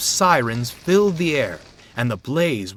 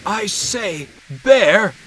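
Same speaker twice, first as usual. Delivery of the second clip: higher, louder hoarse call; 9 semitones higher, 8 dB louder, much slower than usual. A narrator's reading. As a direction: call out with a worn-out voice. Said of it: "he called hoarsely".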